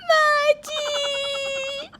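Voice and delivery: long, high wailed cry; drawn-out high wail of crying; sobbing. Acted. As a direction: wail it out in tears. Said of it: "रो क्यों रही है तू?".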